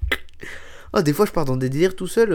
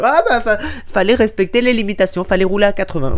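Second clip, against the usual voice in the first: loud, higher, mock-indignant telling-off; scolding officer; 4 semitones higher, 5 dB louder than usual. Officer telling a speeding driver the penalty, indignant scolding. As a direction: scold like an overzealous traffic cop.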